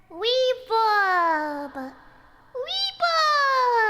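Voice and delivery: loud high echoing shout; reverb voice. Shown as a demonstration. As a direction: call it out into the echo. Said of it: "Can you hear it?".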